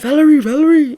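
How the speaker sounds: calling out. Retold or imitated. Friend trying to rouse frozen Valerie, calling out.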